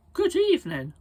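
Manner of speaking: high, loud parrot greeting. Acted. Parrot skeleton greets them in a high parrot voice. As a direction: squawk it like a parrot.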